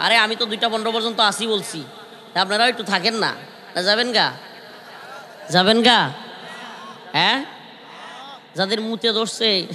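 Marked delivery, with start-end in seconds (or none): none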